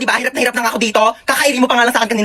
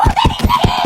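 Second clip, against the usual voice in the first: Delivery shrieked very high, drawn out and distorted; squealed delivery call; slower than usual. Acted.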